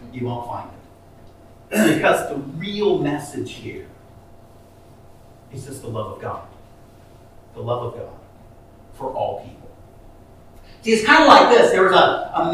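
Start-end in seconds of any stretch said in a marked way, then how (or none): 0.1-2.0 softer repeat. Real, not acted. 2.0-9.7 hushed sincerity. Real, not acted. Preacher drops voice for message.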